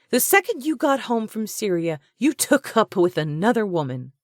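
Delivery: high, loud furious charge; fiery accusation. A narrator's reading. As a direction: get in his face and accuse. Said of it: "Her eyes shot fire".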